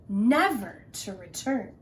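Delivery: ordinary delivery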